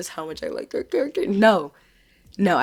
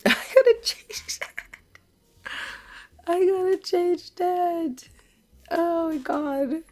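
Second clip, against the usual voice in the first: high, slow laughing whisper; embarrassed laughing; 12 semitones higher, much slower than usual. Real, not acted. Embarrassed by accidental wording.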